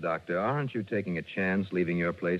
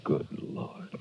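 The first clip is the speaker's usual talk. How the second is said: shocked whisper; 4 semitones lower, 4 dB quieter than usual. Acted. Finding something shocking.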